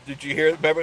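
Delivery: usual speaking voice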